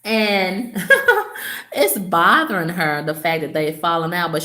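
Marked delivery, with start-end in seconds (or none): 0.8-1.7 short high-pitched gloating laugh; amused laugh. Real, not acted. Speaker relishing the clique's falling out, amused laugh. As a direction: let out a high, amused chuckle.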